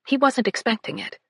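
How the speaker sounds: ordinary delivery, nothing marked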